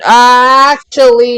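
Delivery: long drawn-out actually; drawn-out objection. Real, not acted. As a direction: hold the word to cut in. Interrupting to correct the others.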